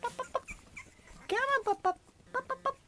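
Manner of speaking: very high sing-song call; puppy call. Real, not acted. Owner calling puppies, very high. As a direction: chant it way up high.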